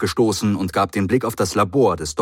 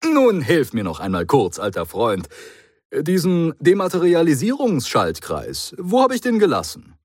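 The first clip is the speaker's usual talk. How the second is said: a hint of a brisk, chatty Doctor; airy reassurance, talking fast past it; lightly voiced breezy Doctor; 9 semitones higher, 4 dB louder than usual. A narrator's reading. The Doctor brushes it off to the Brigadier, lightly voiced and breezy.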